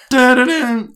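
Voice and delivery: loud, high dramatic "dun-dun-dun" sting; imitated musical sting. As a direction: punch out a film-score stinger. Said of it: "there's that sting".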